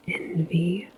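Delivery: ordinary talk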